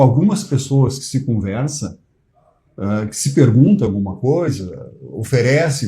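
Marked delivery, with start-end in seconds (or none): none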